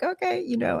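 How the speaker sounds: high little voice quoting oneself giving in; retold acceptance. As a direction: pitch it way up as a light retold agreement. Own reaction, retold in high voice.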